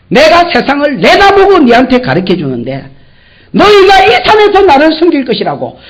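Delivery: God brushing off Moses's fear, rising and loud; God scolding Moses. Acted. God tells anxious Moses to go, loud and rising.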